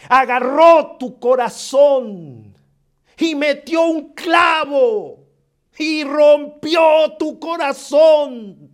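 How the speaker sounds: slow, rising preacher's emphasis; preaching build. Acted. Preacher to the hurting listener, slow and emphatic. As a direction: drive each phrase home from the pulpit.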